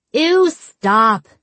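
put-on drawn-out, grossed-out teen protest; disgusted protest. A narrator's reading. Jude swatting her friend's leg, grossed-out protest. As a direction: push away the idea with mild disgust.

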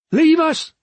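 high, loud order; Tasha's shout back. A narrator's reading. Sister sending the other woman away, shouting. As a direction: shout the command across at her. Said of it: "Tasha shouted back".

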